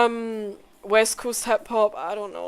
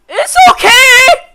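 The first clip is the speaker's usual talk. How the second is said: very loud, high yell; drawn-out shout; 14 semitones higher, 16 dB louder, slower than usual. Real, not acted. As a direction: scream it out, stretching the words. Bursts out over tech death.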